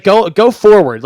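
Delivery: mock-coach yelling him onward; urging shout. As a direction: push the words out, exasperated. Voicing the urging to run.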